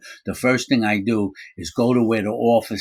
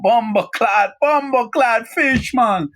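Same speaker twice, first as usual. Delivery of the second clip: high, loud Jamaican patois exclamation; Jamaican accent; 5 dB louder, slower than usual. Retold or imitated. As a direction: shout it like a startled Jamaican inmate. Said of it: "I remember hearing a Jamaican go".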